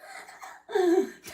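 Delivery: high short bitter laugh. Real, not acted. To the viewers after joking about faking death, bitter laugh. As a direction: laugh at her own dark idea.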